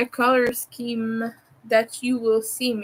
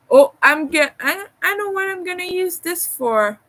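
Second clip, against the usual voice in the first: excited; 8 semitones higher, 4 dB louder than usual. Real, not acted. Sudden idea about the yarn.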